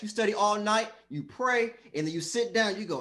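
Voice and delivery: ordinary talk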